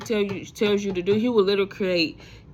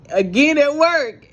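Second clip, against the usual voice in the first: incredulous aside; 7 semitones higher, 6 dB louder, slower than usual. Real, not acted. Exasperated aside about praying at work.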